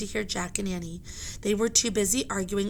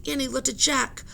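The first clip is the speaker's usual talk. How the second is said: ordinary delivery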